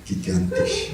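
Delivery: mock German word, hammed up; fake German. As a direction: say it like pretend German. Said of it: "That's fake German".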